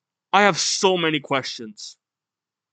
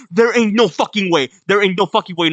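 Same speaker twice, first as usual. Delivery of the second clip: raised, insistent exclaiming; stunned disbelief; 3 semitones higher, 5 dB louder, faster than usual. Real, not acted. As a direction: blurt it out in shocked disbelief. Reacting in disbelief to the reveal.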